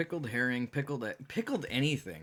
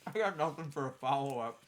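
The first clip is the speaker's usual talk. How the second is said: mouth full; 4 dB quieter than usual. Real, not acted. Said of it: "My mouth is full of egg yolk right now".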